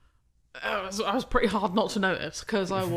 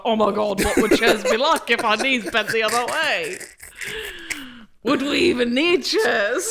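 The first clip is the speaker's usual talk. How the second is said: laughing; 2 semitones higher, 5 dB louder than usual. Retold or imitated. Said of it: "I was like".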